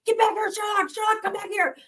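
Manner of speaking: high mock yell calling the cat; high-pitched calling after a runaway cat. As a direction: call him back from the yard, mock panicked. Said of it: "just screaming".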